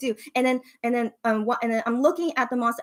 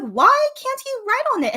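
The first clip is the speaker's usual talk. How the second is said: indignant; 12 semitones higher, 5 dB louder than usual. Retold or imitated. Asking staff, indignant.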